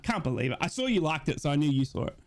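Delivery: normal talking voice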